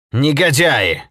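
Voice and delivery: loud, high outburst of outrage; angry cry. A narrator's reading. Holket reacts to the industrialists' threats, crying out angrily. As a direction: cry it out indignantly. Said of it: "вскричал Холкет".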